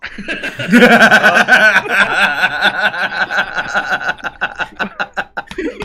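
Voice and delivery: high giddy gnome laugh; gnome cackle. Acted.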